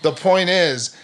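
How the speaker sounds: laughing through an embarrassed admission. Real, not acted. Laughs at her own confession.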